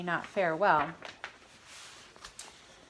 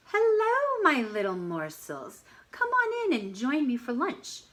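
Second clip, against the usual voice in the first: higher, sugary predator voice; a hungry wolf sweet-talking his dinner; sly wolf; 5 semitones higher than usual. Acted. Wolf luring the animals in, sly sweet voice.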